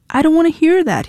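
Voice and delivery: high, loud refusal; shouting. A narrator's reading. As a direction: drown her out with a shout. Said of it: "he shouted".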